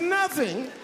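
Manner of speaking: very high rising squeak; feigned shock, pitch way up; mock disbelief. Husband echoes her in feigned shock.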